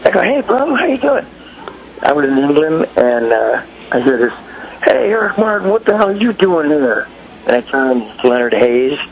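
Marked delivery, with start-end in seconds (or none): 0.2-1.2 high-pitched retold hello; eager greeting. Singer greeting an American overseas, eagerly. 4.8-7.1 high-pitched imitation of a grump calling out; mock a grumpy challenge from across the way; grumpy hail. Grumpy drummer spotting him in England, mock challenging. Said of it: "he was a grumpy son of a bitch".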